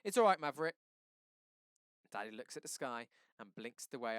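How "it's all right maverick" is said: high, much louder call across the street; hollering out. Acted. A neighbor hollers encouragement to Maverick. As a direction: call out support from a distance. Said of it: "One person hollers out".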